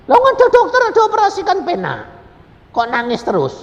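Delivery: very high, loud incredulous protest; loud mock scolding. Preacher challenging the woman who keeps crying, loud mock scolding.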